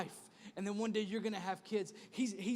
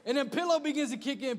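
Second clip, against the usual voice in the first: ordinary talk, nothing marked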